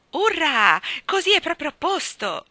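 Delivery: put-on high, loud cheer of a happy boy; delighted shout. A narrator's reading. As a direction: shout it out with joy. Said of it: "gridò Michael".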